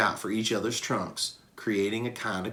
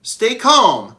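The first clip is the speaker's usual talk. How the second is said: loud, higher call across the water; 11 semitones higher, 11 dB louder than usual. Acted. Bob calling to the sinking baby gorilla, loud. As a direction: shout reassurance over the flood. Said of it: "I call".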